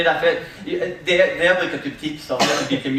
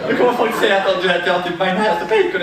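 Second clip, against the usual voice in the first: laughing dismay; 5 semitones higher, 6 dB louder than usual. Retold or imitated. Quoting her dismayed thoughts, laughing.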